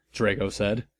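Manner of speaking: ordinary delivery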